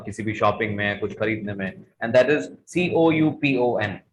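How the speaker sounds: ordinary talk, nothing marked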